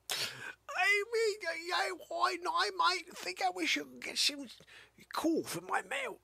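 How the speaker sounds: put-on high, far-off child voice; mock little boy. Little boy wishing for a gift, high. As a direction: play a small boy wishing aloud. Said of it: "He's like".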